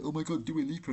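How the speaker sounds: mock a starstruck fan; a light fangirl gush. Mocking fans gushing.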